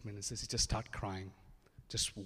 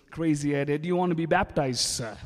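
louder, a bit quick calling out; loud invitation; 4 semitones higher, 10 dB louder than usual. Retold or imitated. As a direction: call him over eagerly. Asking Ed to be baptized.

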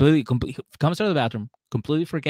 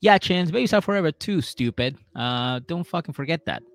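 loud high sarcastic jab; sarcastic mocking; 6 semitones higher, 3 dB louder than usual. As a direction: needle him, mocking. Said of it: "Tanya was like".

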